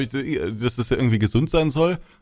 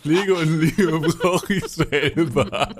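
laughing; 4 semitones higher, much slower than usual. Acted. Echoing partner's line, laughing.

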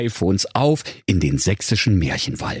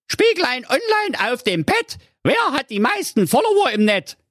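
sehr hohe, keifende Hexenstimme; böse Direktorin. A narrator's reading. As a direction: fragt den Spiegel wie eine Hexe. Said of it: "fragte ihr iPad". (hohe Hexenstimme)

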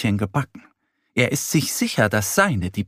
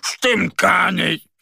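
higher, slow tearful little bear cry; crying shout; 6 semitones higher, slower than usual. Acted. Mo arguing with Annie, slow tearful mock shout. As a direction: wail it out through mock tears. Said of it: "fängt an zu weinen und ruft".

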